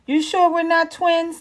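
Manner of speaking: high, playful teasing question. A narrator's reading. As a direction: raise the eyebrow in your voice. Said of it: "raising one eyebrow".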